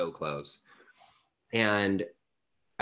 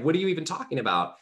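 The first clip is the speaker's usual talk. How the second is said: quick, higher retold disbelief; incredulous; 9 semitones higher, 4 dB louder, faster than usual. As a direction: fire the question back, baffled. Retold stunned reaction.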